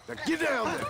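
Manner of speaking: high, sharp angry command; angry order. Acted. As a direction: bark the order at him. Ordering drunk Irish down.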